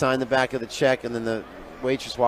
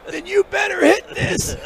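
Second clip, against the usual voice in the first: very high squeezed voice, as if holding in smoke; strained high squeak; 3 dB louder than usual. Retold or imitated. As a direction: push it out high on held breath. Acts Hopper offering joint, holding smoke.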